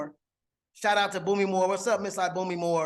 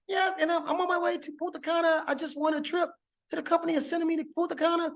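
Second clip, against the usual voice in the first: high, lively imitation of a friend; Boomy Moore voice; 8 semitones higher, faster than usual. Imitating friend Boomy answering his call, high and chirpy. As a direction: mimic her chirpy phone greeting, way up high. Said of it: "my Boomy Moore voice".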